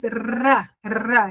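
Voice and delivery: "rá" with a rolled tongue-tip R; tapped R sound. Shown as a demonstration. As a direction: demonstrate "rá" with the tongue flicked behind the teeth. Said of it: "Ele é o som que faz".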